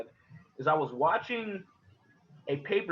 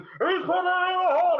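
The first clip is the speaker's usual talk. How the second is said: high, loud announcer yell; wrestling announcer shouting the call; McMahon yelling; 7 dB louder than usual. Retold or imitated. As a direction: bellow it like a ringside commentator. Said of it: "I'm hearing McMahon fucking yelling".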